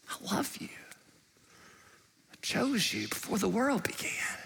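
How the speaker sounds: soft unvoiced whisper, as God to his child; tender whisper. God whispering love to his chosen child, hushed. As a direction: breathe it gently, like a secret of love.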